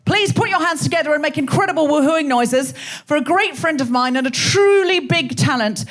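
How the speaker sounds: rousing emcee introduction, stretching the final name; stage announcer. Acted. Host bringing the first guest on stage, rousing announcement.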